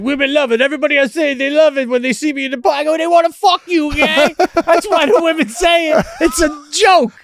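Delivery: high, loud, indignant mock boasting; over-the-top retold salesman rant; hammed-up Italian pitchman. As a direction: shout it up high like an outraged ladies' man. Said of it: "oh, he was Italian with beautiful hair".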